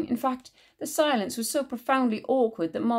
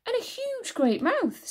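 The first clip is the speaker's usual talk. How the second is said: excited; slower than usual. A narrator's reading. Pointing out the face.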